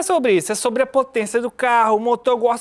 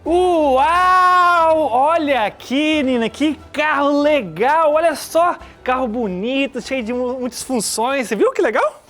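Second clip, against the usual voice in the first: excited; 2 semitones higher than usual. Acted. Theo excited about a car.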